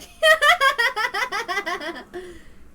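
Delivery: high, loud cackling laugh; loud laughter. Acted. Couple cackling loudly at the newcomers. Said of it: "They started laughing loudly".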